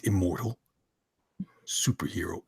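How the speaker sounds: low, hushed words; Boyette impression. Host redoing the tagline in a friend's voice, hushed low impression. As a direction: sink toward a whisper on the last word. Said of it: "let me read it in Boyette's voice".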